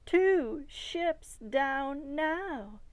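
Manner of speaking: high, loud and slow, drawn out; a child announcing ships are coming; eager child. A narrator's reading. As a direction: stretch it out big with delight. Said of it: "Christina said".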